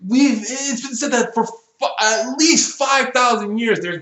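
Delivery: high-pitched, indignant ranting; worked-up, frustrated complaint; exasperated rant. Real, not acted. Rant at plot hole continues.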